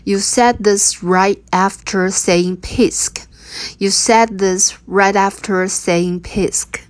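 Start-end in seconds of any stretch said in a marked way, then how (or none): 0.0-3.2 slow and deliberate; slow teaching read. Shown as a demonstration. Teacher modelling the line slowly for learners. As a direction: lay out each word slowly. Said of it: "你扑完就说我可爱".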